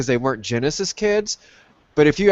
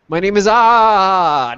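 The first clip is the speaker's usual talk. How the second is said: drawn-out, higher and louder; slow game character voice; 5 semitones higher, 6 dB louder, much slower than usual. Pretend. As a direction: read it like a cheesy game hero. Reading game dialogue in voice.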